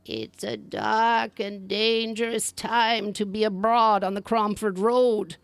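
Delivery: put-on a kindly but eerie old crone, drawn out; old woman in green. Third old woman warns.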